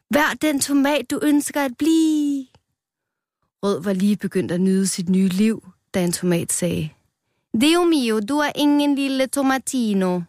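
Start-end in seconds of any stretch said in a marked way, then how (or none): 0.1-2.5 high call, slowing on the last word; mock shout. A narrator's reading. Rød leaps into the salad bowl, calling out high. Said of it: "råbte han". 7.6-10.2 high Italian-flavoured exclamation; Italian character. A narrator's reading. A tomato rejects the newcomer, in high mock-Italian. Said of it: "de er nogle italienske røvhuller".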